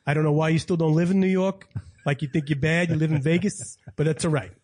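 put-on New York local accent; Long Island accent. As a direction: rib someone like a Long Island native. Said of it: "Everybody there talks like this".

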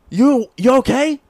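high, loud worried mother voice; worried mom. Retold or imitated. Mom kicking down his door, high and loud with worry. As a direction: burst in as his panicked mom.

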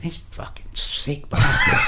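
slow, hushed sneer of disgust; mock disgust. Telling Charlie about an inmate, hushed and slow. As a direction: breathe it out slow like a shared dirty joke. Retelling calling inmate sick bastard.

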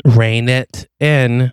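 each word drawn out slowly; slow, emphatic warning. Acted. Partner insisting the detective keep cover, slow emphatic warning.

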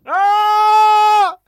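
loud, high panicked yell while chasing the moped; a full-throated scream of alarm. Retold or imitated.

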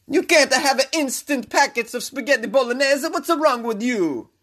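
put-on high-pitched comic Italian accent; mock-horrified Italian indignation; outraged Italian mayor. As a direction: scold in a hammed-up Italian accent.

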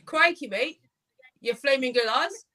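mock Aussie accent full of slang; Australian accent. Shown as a demonstration. Host requests Australian, guest obliges with mock Aussie accent. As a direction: put on a jokey Australian accent. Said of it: "Can you do an Australian accent?".

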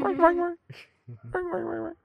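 squeaky high chirping, mark mark; bird chirp. Pretend. Playing a bird chirping her friend's name, high squeaks.

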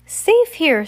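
high, loud little exclamation; crying out. A narrator's reading. Sunny holds up an apple to the islanders, crying out. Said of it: "Sunny cried".

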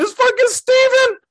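very high, squealed astonishment; thrilled, high-pitched exclamation; excited reveal. Retold or imitated. Retelling the big reveal.

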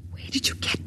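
hushed, frightened whisper. Acted. Woman catching her friend with a forbidden book, whispering. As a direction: breathe it so no one hears.

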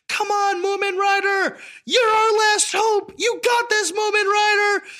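high, loud cheering crowd. Retold or imitated. Stadium crowd urging Moomin Rider on, high loud cheers. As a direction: yell it like a stadium rooting for its last hope.

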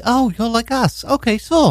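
high, airy imitation British accent. British women accepting him as one of them, British accent. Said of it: "they might be".

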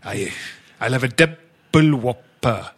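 slow, lightly put-on Welsh; a hint of Welsh, drawn out; Welsh accent. Shown as a demonstration. Ordering a burger to show his mates a light Welsh accent. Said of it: "That's how you do a Welsh accent".

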